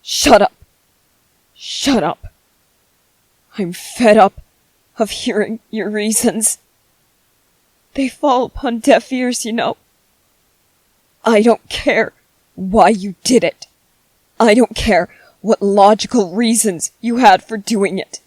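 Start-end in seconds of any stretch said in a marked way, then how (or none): 0.0-0.5 angry snap. Acted. Snapping at the ghost. 3.6-18.2 bitter anger. Acted. Bitter tirade at the ghost.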